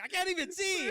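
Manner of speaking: high, loud mocking lady voice. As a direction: squeak it out like the panicked lady. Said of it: "She was like".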